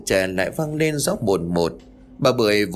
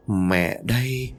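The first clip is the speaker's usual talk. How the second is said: eerie ghost answer; much slower than usual. A narrator's reading. The mud creature answering the son as his mother, slow and eerie. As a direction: draw it out slowly like a creeping ghost. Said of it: "rồi lại cười".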